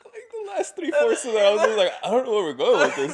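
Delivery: high, loud, laughing words; helpless laughing commentary; cracking up. Real, not acted. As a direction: squeeze the words out through laughter. Co-host cracking up at the rant.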